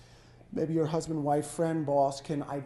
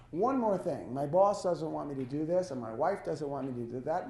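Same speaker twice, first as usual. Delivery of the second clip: ordinary talk, nothing marked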